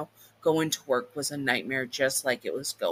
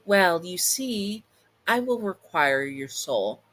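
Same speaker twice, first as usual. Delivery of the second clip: sly demon; 4 semitones higher, slower than usual. Acted. Demon names her price.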